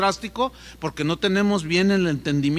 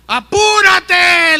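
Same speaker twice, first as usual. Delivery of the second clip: desperate shout; 11 semitones higher, 12 dB louder, much slower than usual. Retold or imitated. Desperate man yells, drops rope.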